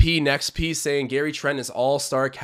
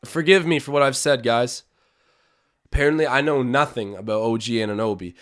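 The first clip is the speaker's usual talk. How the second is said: usual speaking voice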